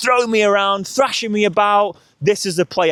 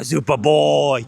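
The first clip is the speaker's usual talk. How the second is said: praise; 4 semitones lower, slower than usual. Real, not acted. Praising the dog.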